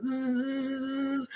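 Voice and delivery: high buzzing hiss, drawn out; long high "zzz" of changing; imitated transformation noise. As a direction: make a high electric sizzle.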